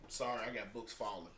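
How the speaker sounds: normal talking voice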